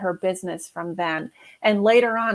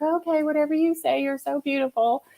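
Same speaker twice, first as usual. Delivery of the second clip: higher, starry-eyed German-accent-free gush, lightly voiced; starstruck admirer; 7 semitones higher than usual. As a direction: gush at her like a smitten fan. Retold fawning over admired neighbor.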